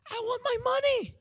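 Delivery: very high, put-on little voice; mock whine. Reluctant would-be pastor wants money, high mock whine. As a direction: squeal it as a hammed-up demand.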